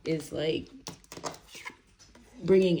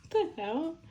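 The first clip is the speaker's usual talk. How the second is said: much higher, startled squeal; 13 semitones higher than usual. Real, not acted. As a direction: react as if something just touched your cheek. Startled by something on her cheek.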